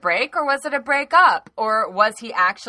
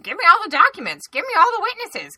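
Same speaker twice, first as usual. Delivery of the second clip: much higher, fast and louder whiny demand; demanding Nunes; 12 semitones higher, 5 dB louder, faster than usual. Retold or imitated. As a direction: whine like a greedy child. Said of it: "who's like".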